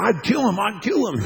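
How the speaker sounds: imitated anger. Imitates furious other buddy.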